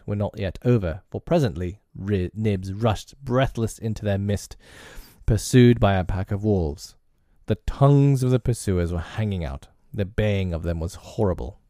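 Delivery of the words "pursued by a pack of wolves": plain reading voice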